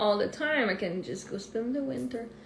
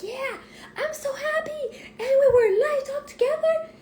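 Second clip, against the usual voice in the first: put-on much higher, laughing-tearful child voice; imitating excited child; 5 dB louder than usual. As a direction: gush with happy tears like a little girl. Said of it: "she's like".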